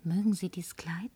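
sehr leise, tonlos gehaucht; verführerisches Flüstern. Acted. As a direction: locken mit einer Frage. Verführt den Barmann. (flüstert dem Barmann verführerisch zu)

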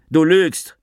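sharp high yell; shouted accusation. Acted. Narrator yells at Avi that he lies, high and loud. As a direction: hurl it at him. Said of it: "schrie ich".

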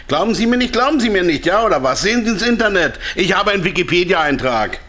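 indignant protest. Acted. Indignant salesman insists on being believed.

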